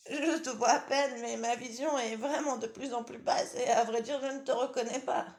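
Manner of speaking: high, loud granny voice with laughter; old grandmother chuckling. Acted.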